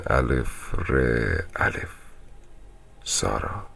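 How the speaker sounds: usual speaking voice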